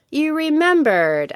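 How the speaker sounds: slow, high-pitched astonishment; sincerely surprised. A narrator's reading. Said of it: "was sincerely surprised".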